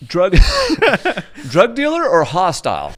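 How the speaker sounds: laughing through the words; real laughter breaking up the question. Real, not acted. Telling the story of the tenant, laughing.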